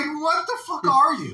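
a high, loud, laughing yell of disbelief; shocked yell. Retold or imitated. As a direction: scream at the creature, half laughing. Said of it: "You're just like".

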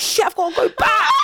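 high-pitched, half-laughing alarm; alarmed yelp breaking into laughter; mock panic. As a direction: blurt it out in comic panic. Panicked realisation while fleeing, acted.

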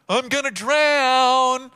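loud, high, long-drawn whine; mocking wail. Jesus mimicking panicked disciples, loud mocking wail. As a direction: belt out an exaggerated helpless wail.

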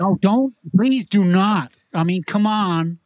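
hammed-up American back-talk; American accent. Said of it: "if we switch over to America".

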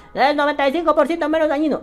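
high-pitched mock reciting of a slogan; mocking parrot. Vaper parroting a statistic, mocking high voice. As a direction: parrot the figure in a silly high voice.

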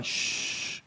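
breathy shh to keep quiet; hushing shh. Acted. Brother hushes his sister so grandma won't hear, shh. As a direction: hush her quickly.

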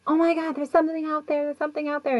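high, rapid imitation of panic; mock freak-out. Investigator spots something outside the window, imitated freaking out. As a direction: send your pitch way up, hurrying as if panicking. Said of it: "he starts freaking out".

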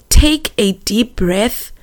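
slow, voiced, gently measured; tender, unhurried retelling of gran; deliberate calming. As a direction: spell out the comfort slowly. Repeats gran's calming words more deliberately.

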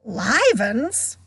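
exaggerated startled cry, swooping pitch; hammed-up surprise in a character voice; mock astonishment. A narrator's reading. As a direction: exclaim in fake amazement. Ghost feigns huge astonishment.

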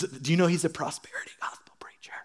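whispered fast, scandalised; gossipy whisper. Retold or imitated. Churchgoer whispering gossip about a preacher.

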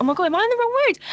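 high, shocked panic. Retold or imitated. Driver facing wrong-way traffic, high shocked panic. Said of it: "I was like".

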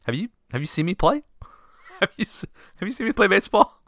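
high, squeaky, laughing disbelief; can't believe they want you to start; incredulous disbelief. Retold or imitated. Benchwarmer told he is starting, incredulous and high. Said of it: "I'm sorry".

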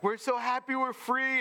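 high, excited mock cheer; a crowd giddy with freedom; mock happy Israelites. Israelites to Moses, a high giddy cheer. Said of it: "They're like".

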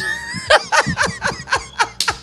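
high shrieking laugh. Real, not acted. Host bursts out laughing.

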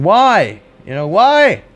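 high, mock outcry of why; yelling crowd. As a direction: imitate shocked people calling out in protest. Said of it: "people are yelling".

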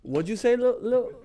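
high, aggressive what'd-you-say; squaring up, pitched high; challenging bouncer. Retold or imitated. Bouncer challenging him, raised.

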